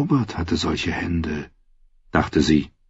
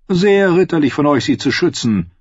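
high, commanding noblewoman; lightly voiced scheming queen; 8 semitones higher, 3 dB louder than usual. A narrator's reading.

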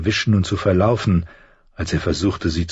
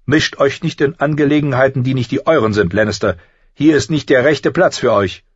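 stern warning; 7 semitones higher, 3 dB louder than usual. A narrator's reading. Thorne warns off Tyrion.

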